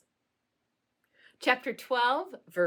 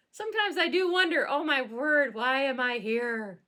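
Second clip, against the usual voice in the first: exasperated; 5 semitones higher, 5 dB louder than usual. Acted. Exasperated mock lament.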